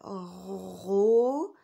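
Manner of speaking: Ro-b-o loud, stretched, each sound separate; broken into syllables. Shown as a demonstration. Teacher helping pupils spell robot, every sound pulled apart. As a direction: sound out Ro-b-o piece by piece with a long rolled r. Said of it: "en décortiquant chaque syllabe".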